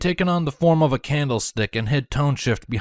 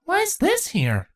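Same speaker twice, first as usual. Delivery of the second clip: put-on high, slow curious child; childish curiosity; slower than usual. A narrator's reading. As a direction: ask like a curious little kid. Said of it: "childish curiosity".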